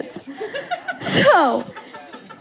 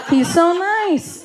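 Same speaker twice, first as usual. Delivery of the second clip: higher, slow imitation of dad's praise; mock gushing; 6 semitones higher, slower than usual. Sister quoting what dad said of the boyfriend, mock gushing. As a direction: stretch out dad's gushing, pitched up.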